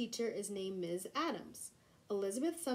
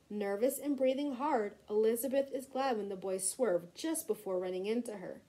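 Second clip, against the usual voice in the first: ordinary delivery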